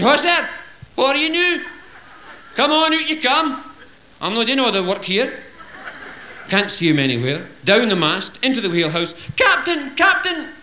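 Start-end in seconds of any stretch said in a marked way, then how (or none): 0.0-5.3 high calling crewman voice. Acted. Crewman calling to lost mate. 9.4-10.5 high urgent cry of Captain; urgent call. Acted. Crewman running to captain.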